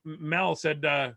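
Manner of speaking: ordinary talk, nothing marked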